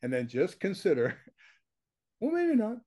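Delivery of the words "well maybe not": high-pitched mock doubt; small objection, pitched way up; voice up an octave. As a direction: imitate a doubter in a put-on high voice. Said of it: "Your voice goes up an octave".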